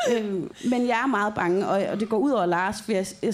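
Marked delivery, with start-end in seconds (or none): none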